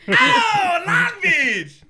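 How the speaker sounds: high, loud sudden realization; excited realization. Retold or imitated. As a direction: yell it as the penny drops. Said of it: "I was like".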